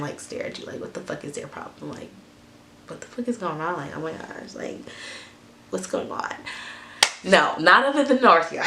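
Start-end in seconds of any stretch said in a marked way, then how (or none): 0.0-2.1 whispering under breath; breathy whispered complaint; whispered aside. Retold or imitated. Mimicking silent staring southerners. 2.9-6.3 near-whisper, muttered to self; hushed muttered confusion; whispered aside. Retold or imitated. Mimicking silent staring southerners.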